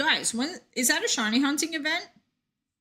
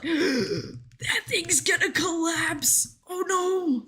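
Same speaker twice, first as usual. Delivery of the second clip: slow, higher mock-panicked gasp of dread; retold panic; 6 semitones higher, much slower than usual. Streamer reliving her scare over the collapsing thing, slow mock panic. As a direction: act out the moment the thing looked about to collapse. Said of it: "I was like".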